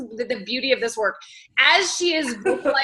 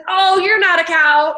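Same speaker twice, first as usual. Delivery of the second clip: higher, louder, slow soothing reassurance; mock coddling; 6 semitones higher, 7 dB louder than usual. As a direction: lay it on thick like comforting a sulking friend. Coddling a friend's complaint.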